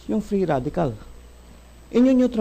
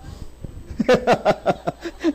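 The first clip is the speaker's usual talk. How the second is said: laugh; 6 semitones higher, 3 dB quieter than usual. Real, not acted. Laughing at own joke.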